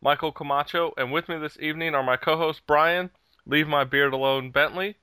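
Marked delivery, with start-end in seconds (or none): none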